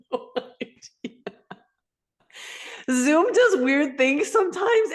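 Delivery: high, laughing through the words; amused and giggly. Real, not acted. Laughing at the Zoom effect.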